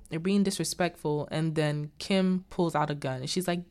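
normal talking voice

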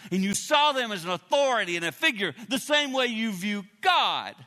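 usual speaking voice

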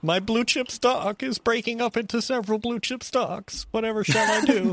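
high mocking fussy rich-person voice; posh fretful shareholder. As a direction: whine like a pearl-clutching investor.

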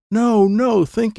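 high, loud, urgent no no; frantic no. A narrator's reading. Boy frantically correcting his aunt's guess. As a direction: stop them fast, they've got it wrong.

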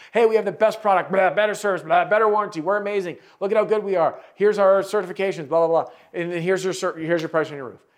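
high, loud, far brash salesman pitch; mocking pushy salesman. Coach mocking a bragging roofing salesman, loud and high pitched. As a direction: ham up a bragging salesman blurting out features. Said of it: "vomiting solutions and telling people how amazing we are".

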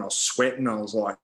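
ordinary talk

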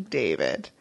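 low show character voice; deep imitated voice. Quoting show line in deep voice.

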